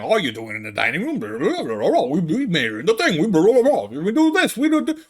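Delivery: babbling parody climbing to a high, fast squawk; mock nagging commenters. Commenters criticizing where it was made, mocking nagging babble.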